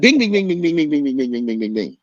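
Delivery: rapid bing punches, high then fading; punch sound effects. Retold or imitated. Teller acting out hitting the rival, punches fading. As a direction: mimic a flurry of punches.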